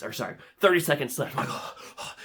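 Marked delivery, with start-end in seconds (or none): 1.5-2.1 very soft breathy gasps; acted anxious gasps, no voice; nervous gasping. Bidder at thirty seconds left, nervous gasps.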